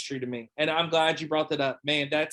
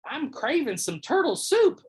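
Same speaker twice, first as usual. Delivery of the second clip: high-pitched cartoon grandma; imitated grandma with big pitch swoops; 10 semitones higher than usual. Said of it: "And she's like".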